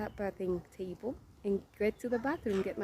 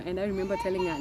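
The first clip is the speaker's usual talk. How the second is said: normal talking voice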